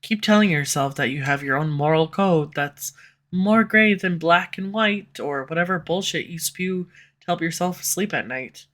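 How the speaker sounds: normal talking voice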